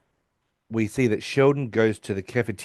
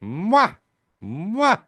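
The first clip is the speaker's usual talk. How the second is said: higher, echoing mwah sounds; acoustic test calls; 9 semitones higher than usual. Retold or imitated. Sheldon testing acoustics from the back row, higher. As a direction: call out mwah to test the room. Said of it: "you hear him at the back going".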